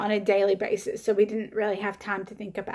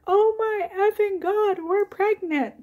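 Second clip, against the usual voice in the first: high-pitched amazed outburst; flipping out; 11 semitones higher, 3 dB louder than usual. Retold or imitated. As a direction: re-enact a shocked, overjoyed announcement. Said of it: "I was flipping out".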